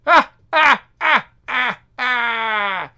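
loud, high crazed laughter; maniacal laugh. Acted. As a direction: cackle like a madman. Villain laughing.

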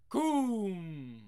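thunder boom. Acted. Thunder sound effect.

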